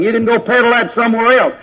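ordinary delivery

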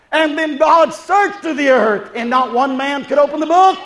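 ordinary talk

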